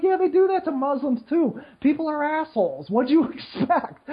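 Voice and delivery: high, dismissive comeback; blunt retort. Retold or imitated. Answering complainers, high and dismissive. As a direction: brush it off with a scoffing reply. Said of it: "And I'm like".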